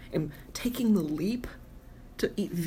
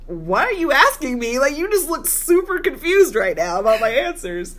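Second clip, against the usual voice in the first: raised, higher, louder protest; incredulous raised voice, a touch mocking; exasperated retort; 8 semitones higher, 7 dB louder than usual. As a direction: call out his suspicious questioning. Retold push-back at date.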